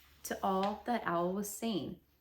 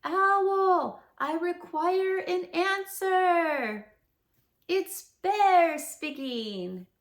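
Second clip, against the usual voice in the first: loud, high call at the door; slow, loud shout through a closed door; 8 semitones higher, 9 dB louder, much slower than usual. Acted. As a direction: bellow it so Owl hears inside. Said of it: "called out in a very loud voice".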